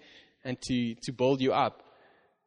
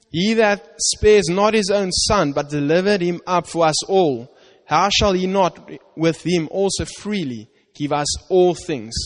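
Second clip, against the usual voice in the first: higher, louder, slow preaching; fervent scripture reading; 5 semitones higher, 4 dB louder than usual. Acted. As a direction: proclaim it with full conviction.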